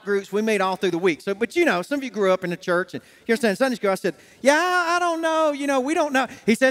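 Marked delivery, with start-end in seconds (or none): 4.4-6.3 reluctant hedging. Retold or imitated. Man putting off his coworker's church invitation, reluctant hedging. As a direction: stretch it out, stalling on the invitation.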